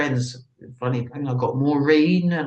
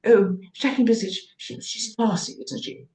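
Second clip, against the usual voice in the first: imitating fans with a much higher, swooning English lilt; mock gushing fan; 7 semitones higher than usual. Mocking fans who call her classy, in a high gushing voice. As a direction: put on the people who call her classy, raised and airy. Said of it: "people say".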